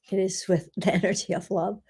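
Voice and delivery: usual speaking voice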